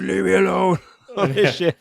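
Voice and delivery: high, loud scream of leave me alone; screamed plea. Retold or imitated. Character screaming at her boyfriend from the bathroom, high loud scream. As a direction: shriek it at the door, desperate. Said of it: "Demi Moore screaming from the bathroom".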